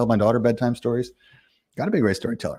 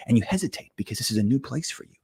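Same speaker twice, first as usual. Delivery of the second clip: game master narration; 3 semitones higher than usual. Acted. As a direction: paint the inn for the table, unhurried. GM describes the player's state.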